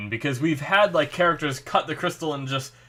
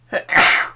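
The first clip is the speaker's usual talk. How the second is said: sneezing; 9 dB louder than usual. Real, not acted. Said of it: "oh, I'm gonna sneeze again".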